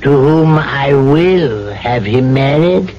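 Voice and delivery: slow, deliberate command; slow insistence. Acted. Case insisting the trader will marry Uma, slow. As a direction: lay down the law slowly.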